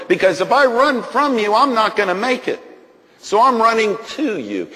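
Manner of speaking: high, loud fervent cry; impassioned climax. Retold or imitated. Preacher reaching his prayer's climax, impassioned. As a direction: cry it out with all your heart. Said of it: "I said".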